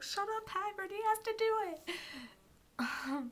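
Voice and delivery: high, far-off fangirl squeal, slowing down; mock-desperate plea. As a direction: beg the character from the screen. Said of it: "I was like".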